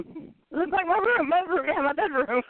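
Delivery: high mock Paul voice; imitating Paul. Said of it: "Paul goes".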